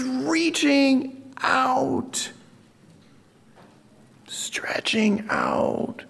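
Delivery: high, drawn-out words thinning to a whisper; suspenseful slow motion. Acted. Preacher narrating Jesus reaching to the leper, slow-motion suspense. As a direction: stretch each word, fading into breath.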